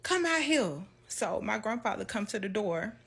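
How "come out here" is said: high, loud call for her husband; grandma calling. Retold or imitated. Grandma hollering for her husband, high and loud. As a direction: holler to the house. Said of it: "So she like".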